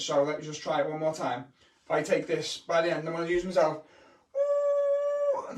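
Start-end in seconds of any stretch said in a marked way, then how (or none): 4.4-5.6 a long, high held ooh; high mystical ooh. Acted. Summoning the magic for viewers, high ooh. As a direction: build a spooky wizard moment.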